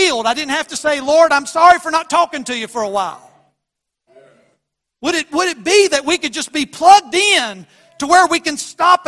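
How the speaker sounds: loud, high pulpit voice; preaching at full force. Acted. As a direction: drive it home to the congregation.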